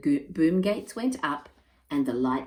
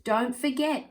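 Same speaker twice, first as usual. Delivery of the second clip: calling out; 9 semitones higher than usual. Acted. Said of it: "called Jessie".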